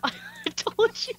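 giggling it out; laughing. Real, not acted. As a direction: laugh through the words. Teasing friend who fell.

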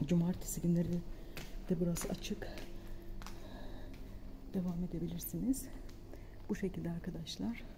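hushed library whisper. Real, not acted. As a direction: keep it quiet in the library. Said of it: "sessiz olacağız".

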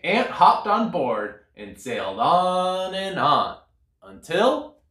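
ordinary delivery, nothing marked

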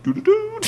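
high-pitched sung phone alert; imitated iPhone text chime. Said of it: "it's the iPhone text message sound".

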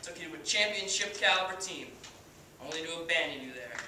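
cocky washed-up pitcher, swaggering speech; brash halftime pep speech, full of bravado; Kenny Powers. Acted. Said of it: "as Kenny Powers".

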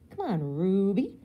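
slow, loud coaxing call. A narrator's reading. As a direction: coax her like a cheerful host. Ken coaxing Rue.

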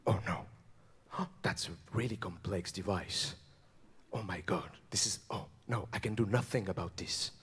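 very low, hushed superhero voice; Batman. Acted. Superhero baffled by a bomb of wine and cheese, deep hushed voice. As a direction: keep it deep, soft and deadly serious. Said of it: "and he said".

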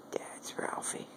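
soft whisper, stress on Ralphie; tender whisper. Real, not acted. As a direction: whisper it close to the cat. Whispering to the cat.

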